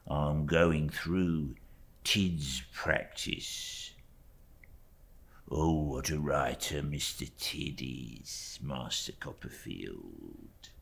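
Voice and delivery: slow, hushed, oily humble clerk; a hushed, slow servile clerk; fawning clerk. Acted. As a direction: creep through it, eager to please. Uriah's voice in story reading.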